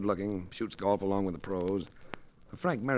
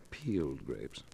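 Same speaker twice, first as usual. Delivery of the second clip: whispered aside; 7 semitones lower, 9 dB quieter, slower than usual. A narrator's reading. Narrator's sly aside.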